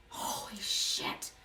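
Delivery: a slow, breathless whispered curse; whispered awe. Real, not acted. Reader spooked by a vanished card, whispered "holy shit". Trippy thing just happened.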